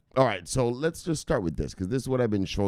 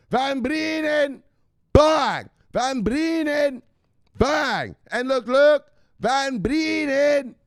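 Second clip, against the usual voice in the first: high, loud, ranting at "woman"; hyped crypto persona; 8 dB louder, slower than usual. Acted. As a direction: preach crypto to the woman like a hype man.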